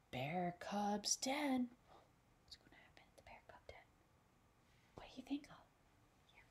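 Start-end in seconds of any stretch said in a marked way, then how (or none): 2.4-6.4 hushed suspenseful whisper. Real, not acted. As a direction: whisper the question to build suspense. Whispered question to children.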